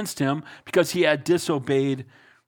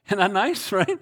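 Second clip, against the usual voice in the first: normal talking voice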